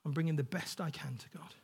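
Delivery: soft, hushed inner thought; Abel's inner voice. Acted. Abel bringing his best to God, quiet inner voice. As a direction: say it quietly, as if from the heart. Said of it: "God saw Abel's heart".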